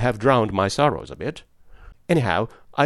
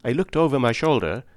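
ordinary delivery, nothing marked

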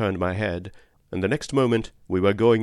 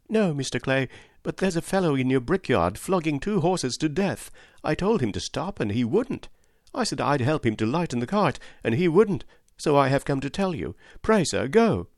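put-on higher-pitched, agitated boy's report; a touch of breathless urgency, pitched up; excited; 6 semitones higher than usual. A narrator's reading. Joe reporting the flogging to Mister Clay, excited. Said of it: "Joe's voice shook with excitement".

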